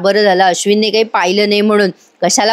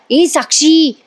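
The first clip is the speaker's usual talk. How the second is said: higher call across the room; 7 semitones higher, slower than usual. Retold or imitated. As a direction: call her name to get attention. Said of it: "पुन्हा पुन्हा आवाज देत असते".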